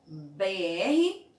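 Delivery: ordinary delivery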